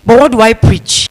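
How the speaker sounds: usual speaking voice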